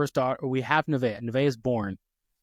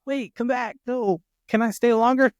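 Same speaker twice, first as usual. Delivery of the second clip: mock panicked plea; 9 semitones higher, 5 dB louder than usual. Panicked dad as nurse leaves.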